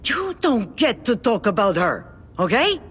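loud, rising fury; angry outburst. Acted. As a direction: shout him down for insulting her. Defending Emma against insult.

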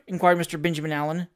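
ordinary delivery, nothing marked

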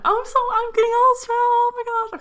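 high, loud emotional squeal; overcome with emotion. Real, not acted. Vlogger overwhelmed about getting dogs, emotional squeal. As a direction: let it burst out high, loud and fast. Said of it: "trying to get emotional".

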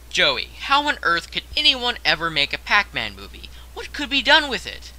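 lightly voiced doubtful viewer; a hint of a skeptical fan, mock incredulous; imagined viewer. Imagined viewer asking Joey about a Pac-Man movie, mock incredulous. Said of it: "I know what you're thinking".

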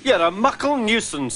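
high, testy complaint; angry twin. A narrator's reading. Twin engine scolding the van, sharply annoyed. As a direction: scold a bothersome brother. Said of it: "was angry".

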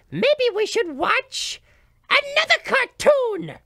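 ordinary delivery

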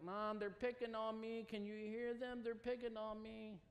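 put-on high, whining child's voice tattling to mom; whiny little sister. Sister whining to their dying mom about being picked on. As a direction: whine it like a kid telling on her siblings. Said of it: "she was the whiner".

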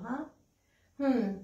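normal talking voice

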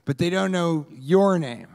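usual speaking voice

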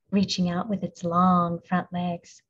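ordinary delivery, nothing marked

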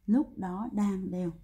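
slow, words stretched out; slow emphatic repeat. Shown as a demonstration. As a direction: repeat it slowly so each word lands. Teacher repeats the key phrase slowly. (nhắc lại chậm, rõ từng chữ)

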